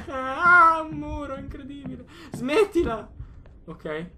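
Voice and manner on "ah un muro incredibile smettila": scared high voice. Real, not acted. Scared in game.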